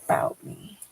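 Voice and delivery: low voice, dropping to a whisper; creepy stranger hiding who he is; disguised deep voice. Pretend. Playing mysterious anonymous guest.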